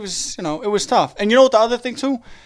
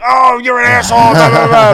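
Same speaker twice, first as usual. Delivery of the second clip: mocking critic; 6 semitones higher, 9 dB louder than usual. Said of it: "my Facebook is gonna be like".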